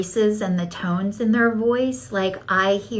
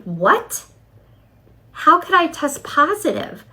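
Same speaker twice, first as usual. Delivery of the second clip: high, incredulous retold cry of shock; a disbelieving yelp, pitched way up; shocked disbelief; 7 semitones higher than usual. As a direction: replay the shock of hearing the news. Retold shock at drug test.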